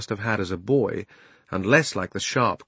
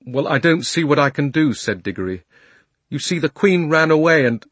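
higher, louder nervous schoolboy; anxious boy Diggory; 5 semitones higher, 9 dB louder than usual. A narrator's reading. Diggory nervously excusing himself to the lion. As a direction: blurt out excuses fast.

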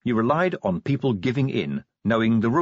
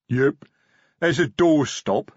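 rumbling troll; 5 semitones higher, 4 dB louder, slower than usual. Acted. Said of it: "rumbled Detritus".

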